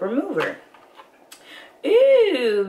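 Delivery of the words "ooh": intrigued ooh. Real, not acted. Seeing the cleanser.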